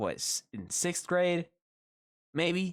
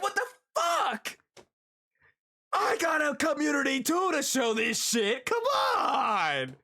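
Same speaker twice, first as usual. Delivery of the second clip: high-pitched outraged yelling; exasperated shouting; 9 semitones higher than usual. Real, not acted. Streamer with his own community to show clips to, exasperated yelling. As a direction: plead your grievance at a high-pitched yell. Ranting about the withheld clips.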